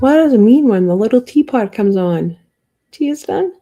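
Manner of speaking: feigned puzzlement, playing dumb; sarcastic. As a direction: ask it sarcastically, loud at first and lilting up. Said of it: "I'm saying it sarcastically".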